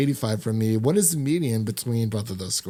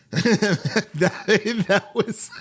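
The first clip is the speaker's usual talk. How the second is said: words breaking up with laughter, pitched up; can't get the words out for laughing; 9 semitones higher, much slower than usual. Real, not acted. Cohost laughing about the show's funny ending, laughing.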